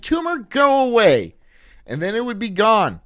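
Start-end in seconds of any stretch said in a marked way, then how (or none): 0.0-1.4 mock command. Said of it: "I'd just be like".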